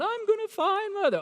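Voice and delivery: high, swooning mock voice; dreamy hopeful student. Professor mocks a student who came to find a spouse, high and swooning. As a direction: lilt it like a starry-eyed girl.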